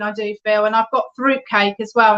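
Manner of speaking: usual speaking voice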